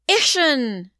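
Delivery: put-on loud, high toddler shout. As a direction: shout the word proudly like a toddler. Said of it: "he goes".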